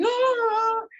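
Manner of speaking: long, loud, high wail to the sky; anguished wail. Retold or imitated. Big guy on his board, wailing at the gods. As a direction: cry out like cursing the gods. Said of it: "like cursing the gods".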